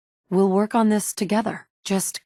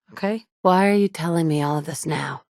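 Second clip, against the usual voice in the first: ordinary delivery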